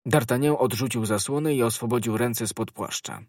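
ordinary delivery, nothing marked

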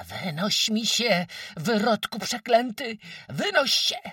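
very high, loud raging scream; frenzied roar. A narrator's reading. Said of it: "ryczała nieprzytomnie". (nieprzytomny, wściekły ryk)

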